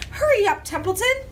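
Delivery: high, loud urging; urgent. A narrator's reading. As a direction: push him to hurry. Wilbur urging the rat.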